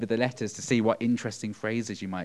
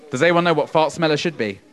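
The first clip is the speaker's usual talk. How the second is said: ordinary delivery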